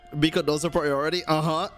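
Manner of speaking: ordinary talk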